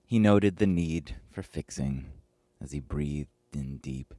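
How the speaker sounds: usual speaking voice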